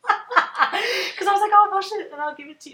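high, loud, laughing through the words; giggling so hard the quote goes up high; laughing at her own offer, high and loud. Retold or imitated. Laughing while retelling the offer.

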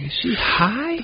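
incredulous. Retold or imitated. Retells his disbelief at her offer.

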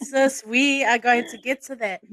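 usual speaking voice

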